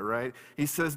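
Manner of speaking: normal talking voice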